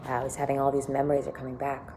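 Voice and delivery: ordinary delivery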